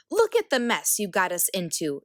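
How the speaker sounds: usual speaking voice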